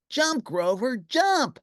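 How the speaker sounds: high, loud urgent calling; urgent cry. A narrator's reading. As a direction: urge him to jump. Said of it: "cried the lovely Lucretia".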